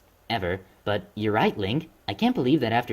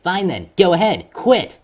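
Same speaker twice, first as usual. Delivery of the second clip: angry shout; 6 semitones higher, 7 dB louder, slower than usual. Acted. Shouting during quarrel.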